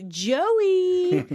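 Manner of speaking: high, loud drawn-out name; insistent self-introduction. Retold or imitated. Joey waving at his hand twin, insistent. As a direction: call it out as if it explains everything.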